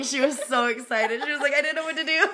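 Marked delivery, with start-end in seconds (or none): 1.5-2.2 higher, laughing imitation of tearful excitement; mock crying. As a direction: play an overwhelmed fan, half laughing. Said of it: "she's, like, crying".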